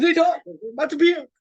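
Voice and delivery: exaggerated radio host; imitating puker DJs. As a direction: push it out like a puking announcer. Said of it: "we call 'em pukers".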